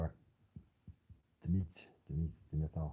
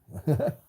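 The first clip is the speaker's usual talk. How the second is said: loud, high burst of laughter; short laugh; 10 semitones higher, 9 dB louder than usual. Real, not acted. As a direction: break into a quick, high laugh. Laughs about having been busy.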